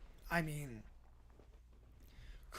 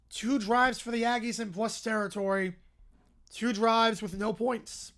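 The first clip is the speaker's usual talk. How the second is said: loud, higher-pitched big-play call; punchy live football commentary, voice lifted; excited play-by-play; 3 semitones higher, 7 dB louder than usual. Real, not acted. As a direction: call the interception with rising punch.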